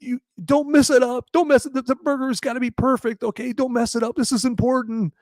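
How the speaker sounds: very high, mock-crying plea; imitating tearful Edward. Condemned man begs guard over last meal, mock tearful. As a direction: plead high like a man in tears, hammed up. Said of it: "and he's crying".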